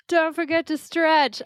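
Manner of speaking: bright, slow, upbeat send-off; cheery sign-off. Real, not acted. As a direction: toast it to the crowd. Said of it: "trying to make it sound good for a cheers".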